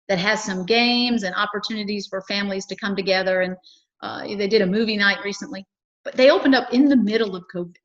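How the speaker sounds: usual speaking voice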